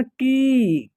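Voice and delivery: की stretched on a long ee; drawn-out vowel. Shown as a demonstration. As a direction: pull out the vowel in की. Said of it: "खींच करके होगा".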